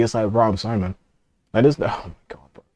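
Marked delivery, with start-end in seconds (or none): none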